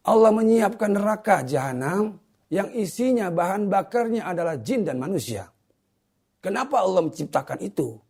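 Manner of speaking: high, emphatic sermon delivery; forceful preaching. Real, not acted. Preacher warning viewers about neraka jahanam, forceful preaching.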